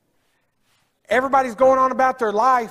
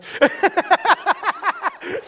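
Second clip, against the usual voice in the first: high, hearty laugh; 11 semitones higher than usual. Real, not acted. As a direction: burst into a high, long laugh. Laughing at his own joke.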